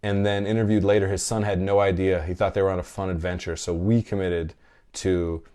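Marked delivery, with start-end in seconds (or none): none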